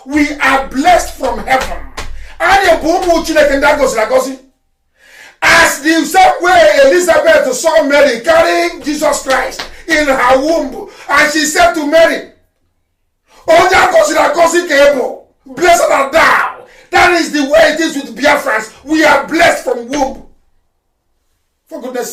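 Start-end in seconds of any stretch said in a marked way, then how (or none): 5.4-16.7 fervent preaching. Real, not acted. Speaker preaching to Biafrans about being blessed, fervent loud preaching. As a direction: proclaim it from the pulpit.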